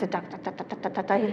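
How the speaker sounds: normal talking voice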